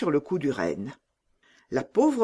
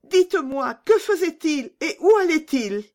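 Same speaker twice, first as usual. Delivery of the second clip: high, louder pleading questions; imploring little Gerda; 12 semitones higher, 5 dB louder than usual. A narrator's reading. Gerda begging pigeons for news of Peter, high and pleading. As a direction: plead urgently in a high child's voice. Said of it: "comme pour les implorer".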